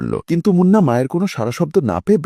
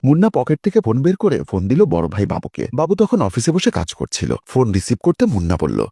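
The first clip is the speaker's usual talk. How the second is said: usual speaking voice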